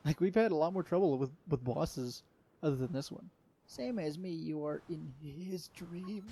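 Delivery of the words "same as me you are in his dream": slow, soft, drawn-out character read; game character voice. Acted. Player reads game dialogue aloud, slow hushed character voice. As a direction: intone it quietly, drawing out the words.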